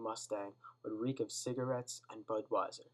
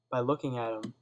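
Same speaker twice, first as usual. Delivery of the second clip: ordinary delivery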